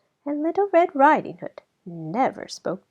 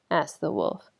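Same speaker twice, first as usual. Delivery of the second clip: ordinary delivery, nothing marked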